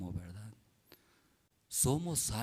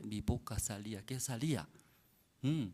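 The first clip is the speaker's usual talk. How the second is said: ordinary talk, nothing marked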